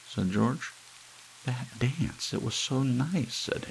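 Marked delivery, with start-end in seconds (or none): 1.4-3.3 high, simple wife voice; high dreamy Hazel; Hazel's voice. A narrator's reading. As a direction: gush sweetly like a simple housewife.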